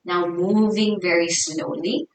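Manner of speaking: soft, hushed meditation guidance with long pauses; guided meditation. Real, not acted. Yoga teacher settling the class into their breath, soft guided meditation. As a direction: ease them inwards, softly and unhurried.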